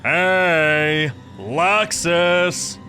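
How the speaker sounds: high, loud, drawn-out hail; eager long-distance call. Acted. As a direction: holler a name to find someone hiding. Friend calls out for Luxus.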